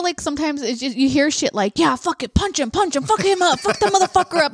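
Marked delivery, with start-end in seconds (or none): 1.8-4.5 higher-pitched, mounting crowd chant; mock rowdy cheering. Rowdy spectators urging a punch, mock hyped-up yelling. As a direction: egg the fighter on, building in pitch.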